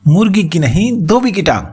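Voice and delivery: lightly voiced happy cry of the old clerk; a touch of glee. A narrator's reading. Deaf clerk happily shouts his mix-up, a touch of glee. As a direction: call it out pleased with yourself. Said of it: "clerk खुशी से चिल्लाया".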